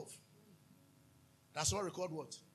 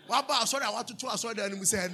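normal talking voice

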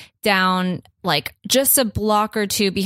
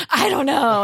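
higher-pitched mock panic; retold frantic worry; 6 semitones higher than usual. Dog's new owner answering calm cafe owners, hammed-up worry. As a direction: play up your own jittery doubt. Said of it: "acting like an insane person".

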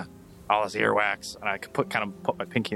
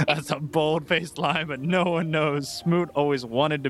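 laughing; 7 semitones higher than usual. Real, not acted.

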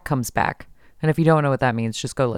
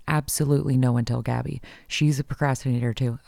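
ordinary delivery

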